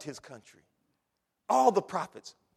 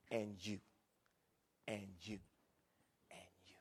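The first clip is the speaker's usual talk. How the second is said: each and you softer till whispered; fading to whisper; 8 semitones lower, 16 dB quieter than usual. Shown as a demonstration. Preacher pointing at each listener, fading to whisper. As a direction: let it fade into a whisper. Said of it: "pointing individually".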